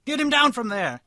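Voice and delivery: high, loud angry-mob shout; shouted jeer, pitched high; crowd yell. A narrator's reading. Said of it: "yelled many members of the crowd".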